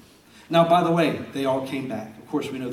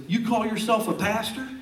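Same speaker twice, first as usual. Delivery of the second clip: angry brother; 9 semitones higher than usual. Retold or imitated. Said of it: "he goes".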